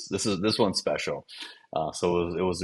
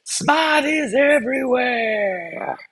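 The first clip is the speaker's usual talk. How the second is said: put-on long, high commentator shout in thick Boston accent; Tommy Heinsohn yelling; 3 dB louder, much slower than usual. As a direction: holler it like an excited Boston play-by-play guy, vowels stretched. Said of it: "thick Boston accent, ... known for yelling at the refs".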